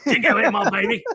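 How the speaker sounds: loud, high, broad Aussie put-on; hammed-up Australian accent. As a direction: shout it in cartoon Australian. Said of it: "I think he's Australian".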